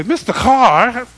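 a shouted, drawn-out "Mister Carr!"; shocked cry. Retold or imitated. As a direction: yell it as if they think he's crazy. Congregation shouting at preacher.

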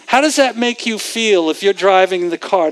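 normal talking voice

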